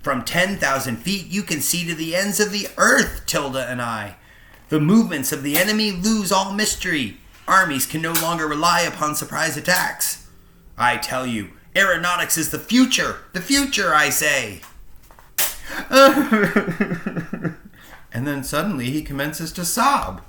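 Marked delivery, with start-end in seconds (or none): 0.0-14.6 put-on high, loud, proud young pilot voice; eager young aviator. Young balloonist boasting. 15.4-17.7 put-on loud, high laugh of a proud young man; hearty laugh. Pilot laughs after boasting.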